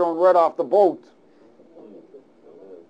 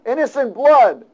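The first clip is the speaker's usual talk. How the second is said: higher, louder comic pleading prayer; mock praying sailors; 6 semitones higher, 4 dB louder than usual. Sailors praying before throwing Jonah, comic plea. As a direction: plead with the Lord half joking. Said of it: "They said".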